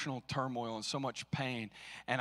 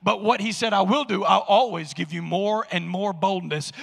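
full-force preaching; 7 semitones higher, 11 dB louder than usual. Acted. Pastor rousing the congregation about opposition, full-force preaching.

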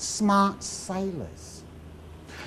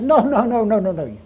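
rapid string of protesting noes, higher and louder at first; emphatic mock refusal; 4 semitones higher, 5 dB louder than usual. English people rejecting a French king, emphatic mock refusal. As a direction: act out a crowd's indignant refusal, easing off.

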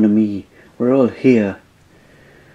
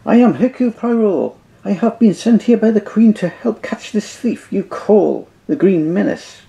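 high, fussy Belgian detective; light Belgian-French accent; Poirot's accent; 5 semitones higher than usual. Acted. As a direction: correct them with pride. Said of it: "the great French detective".

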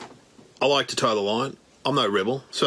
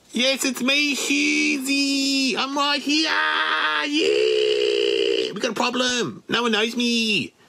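Husey voice; 9 semitones higher, much slower than usual. Retold or imitated. Mask speaks for the friend, in a higher shouting impression. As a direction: shout that it's you, pitched up. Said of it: "And it says".

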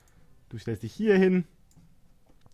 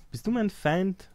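higher, slow, drawn-out challenge; mock threatening question; 7 semitones higher, slower than usual. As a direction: ask it again like a suspicious ruler. Repeats question, higher and slower. (misstrauisch gedehnte Frage, höher und langsamer)

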